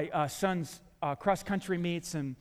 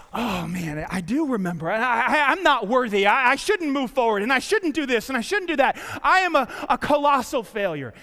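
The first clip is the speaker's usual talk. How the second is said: despairing self-talk; 4 semitones higher, 7 dB louder than usual. Acted. Voicing defeated self-talk.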